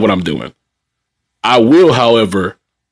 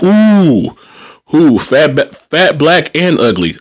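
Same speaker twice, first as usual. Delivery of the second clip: stung amusement; 6 semitones higher, slower than usual. Real, not acted. Stung by a resident's insult.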